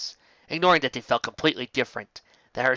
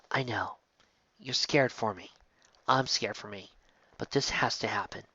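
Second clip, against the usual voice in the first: tender reassurance; 7 dB quieter than usual. Acted. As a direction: hush her fears gently. Tender reassurance to scared partner.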